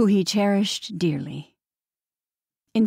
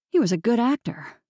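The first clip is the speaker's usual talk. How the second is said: plain reading voice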